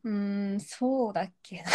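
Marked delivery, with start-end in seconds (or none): 1.7-1.7 laughing. Real, not acted. Unsure memory, bursts out laughing.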